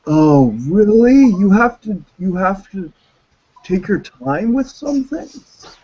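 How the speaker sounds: high, drawn-out mock cop voice, sarcastic. Mocking the cops who came to his house, high sarcastic drawl. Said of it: "And they're like".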